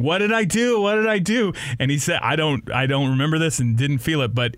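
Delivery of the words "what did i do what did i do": high, insistent repeated plea; pleading confusion. Retold or imitated. Cop yelling at him, high pleading repeated question. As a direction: beg to know what you did wrong. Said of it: "I just keep saying".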